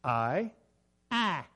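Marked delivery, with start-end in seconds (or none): 1.1-1.4 higher, Kentucky-style vowel in "I"; mimicked Kentucky "I"; Kentucky accent. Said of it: "He would say".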